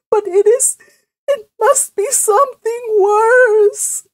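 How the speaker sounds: very high, loud wailing sobs; hammed-up tearful outpouring; sobbing. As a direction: wail through tears, stretching the last words. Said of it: "she sobbed".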